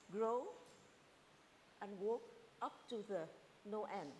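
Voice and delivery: usual speaking voice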